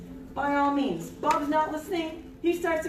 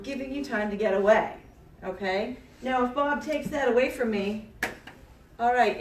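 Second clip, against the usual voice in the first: usual speaking voice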